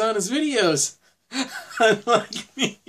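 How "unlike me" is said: high, slow laugh through the words; words breaking into a real laugh. Real, not acted. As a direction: laugh at your own expense. Joking at his own expense, laughing.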